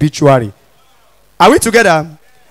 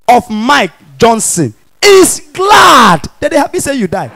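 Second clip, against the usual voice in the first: mock solemn announcement; 2 semitones higher, slower than usual. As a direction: read the obituary like grand news. Mock reading rich obituary.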